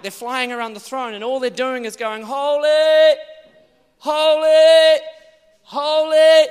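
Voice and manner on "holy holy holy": loud worshipful cry. Retold or imitated. Seraphim crying before the throne, ringing loud. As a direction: ring out high and loud in awe.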